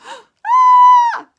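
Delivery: very high, loud shriek; terrified yelp. A narrator's reading. Rabbit startled in the mist, shrill frightened yell. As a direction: jump in fright. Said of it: "yelled Rabbit".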